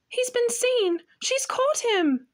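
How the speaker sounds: put-on high, anxious young girl's voice; panicked thought of a scared girl; Lucy fearing Mr Beaver is caught, frightened and high. A narrator's reading. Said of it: "frightened them very much".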